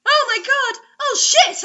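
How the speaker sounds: high, loud hammed-up cries; cried out. Reader acts out the heroine's climax with loud, high cries. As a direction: cry out in over-the-top ecstasy. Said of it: "I cried out".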